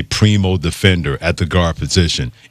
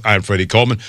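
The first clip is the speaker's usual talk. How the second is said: ordinary delivery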